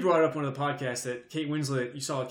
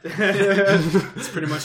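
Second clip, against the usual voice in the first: laughing; 5 semitones higher, 8 dB louder, much slower than usual. Real, not acted. Laughing at friend's description.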